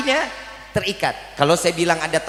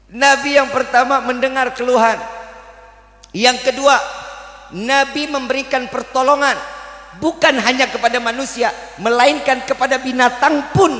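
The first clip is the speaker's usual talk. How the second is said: raised, higher preaching voice; 8 semitones higher than usual. Acted. Preacher teaching the congregation, raised preaching voice. Preacher lists the Prophet's deeds.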